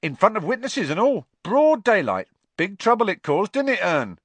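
a high, loud, chatty voice; cheeky Cockney conductor. A narrator's reading. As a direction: gossip like a bus conductor. Stan gossips with Ern.

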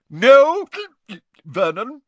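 put-on very high, loud drunken aunt; tipsy Aunt Marge. A narrator's reading. Aunt stopping Vernon, high and tipsy. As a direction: hold him off like a drunk aunt. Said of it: "hiccuped Aunt Marge".

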